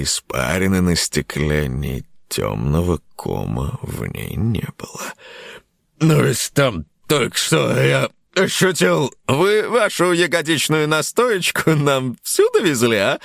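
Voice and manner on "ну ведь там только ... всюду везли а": cheerful, high teasing host. Acted. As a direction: tease him cheerfully. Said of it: "весело осведомился ведущий".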